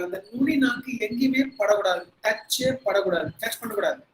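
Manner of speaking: ordinary talk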